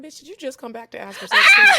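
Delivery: laughing mock shout. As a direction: bark it out at the friend, cracking up. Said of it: "I'm like".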